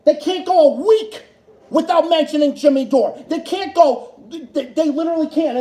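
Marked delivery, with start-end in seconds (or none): none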